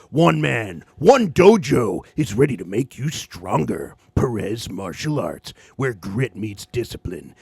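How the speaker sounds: low, dramatic trailer narrator; deep epic trailer read; movie trailer voice. Acted. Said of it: "you're the movie trailer voice".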